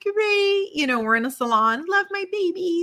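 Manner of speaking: high, bright, over-chipper; fake cheerful front. New mother faking cheer to her hairdresser, chirpy. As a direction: put on a brave happy face, quickly. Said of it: "And I was like".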